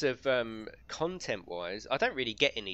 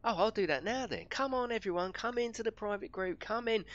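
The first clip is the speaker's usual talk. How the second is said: much higher, excited re-enactment; mock over-eager welcome, pitched way up; eager invitation; 11 semitones higher than usual.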